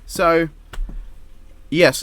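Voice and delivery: usual speaking voice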